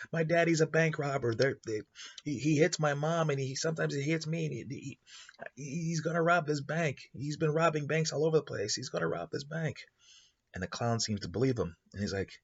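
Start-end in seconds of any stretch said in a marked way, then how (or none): 0.1-9.8 put-on high child voice, anxious and insistent; a scared boy begging to be believed; pleading kid. Kid begging the clown to believe him, high anxious pleading.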